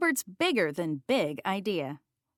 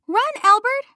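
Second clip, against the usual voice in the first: high, loud warning cry; urgent shout; 14 semitones higher, 12 dB louder than usual. A narrator's reading. Sister warning Albert about the cat, frantic shout. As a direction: yell at him to run for his life.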